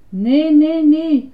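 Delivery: high, loud lament; despairing sigh. Acted. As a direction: wail it in acted despair. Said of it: "zuchtte Ernie". (wanhopig, luid gejammer)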